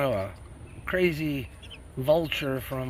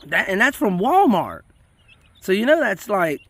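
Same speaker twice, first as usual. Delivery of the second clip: ordinary talk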